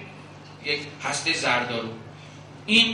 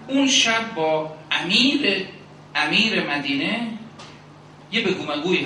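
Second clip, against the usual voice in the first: slow, louder sermon build; fervent preaching; 3 semitones higher, 5 dB louder than usual. Acted. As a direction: draw it out and lift the crowd.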